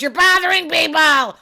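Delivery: loud, high mimicked reproach; imitated scolding yell. Retelling a wife scolding her husband, imitated yell. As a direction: holler it like a fed-up wife.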